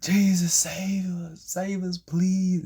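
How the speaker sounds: high, loud desperate plea; crying out. Acted. Peter in the storm to Jesus, crying out. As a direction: plead for rescue, acted and urgent. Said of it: "he's just crying out to Jesus".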